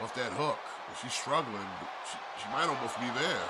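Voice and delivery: excited live play-by-play; wrestling commentator. Acted. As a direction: call the match live. Live play-by-play of ladder match.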